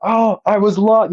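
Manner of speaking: high, breathless blurting; out of breath. Retold or imitated. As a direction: gasp it out after a run. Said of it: "I'm all out of breath".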